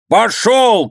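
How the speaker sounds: high, dismissive yell; angry shout. A narrator's reading. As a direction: send him off with a high shout. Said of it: "кричал воевода". (сердитый высокий окрик)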